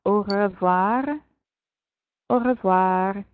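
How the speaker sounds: au revoir drawn out with a French r; slow French demo. Shown as a demonstration. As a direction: model au revoir slowly. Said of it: "So try out au revoir".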